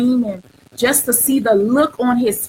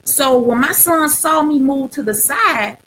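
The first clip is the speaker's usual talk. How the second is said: normal talking voice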